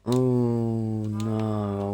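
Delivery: long, drawn-out groaning lament; drawn-out dismay. Real, not acted. Streamer facing a big flattening job, dismayed. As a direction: stretch both words out in mild dismay.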